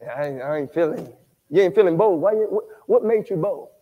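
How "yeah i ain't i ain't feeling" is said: low, quiet mock dejected guy; a hint of a deflated man; lower mumbling man. Preacher imitating a man leaving church, low mumble. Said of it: "Maybe men talk like that".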